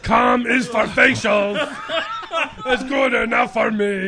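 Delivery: high, slow singsong monster voice; loud, hammed-up comic monster; bukkake monster. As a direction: belt it out as a silly monster. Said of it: "He's the bukkake monster".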